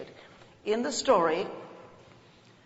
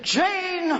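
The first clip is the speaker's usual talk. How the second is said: put-on high, loud call of an old friend; imitating Margaret Mee; 11 semitones higher, 5 dB louder than usual. Imitating her adopted mother calling her name, high. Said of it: "I'm imitating exactly what she sounded like".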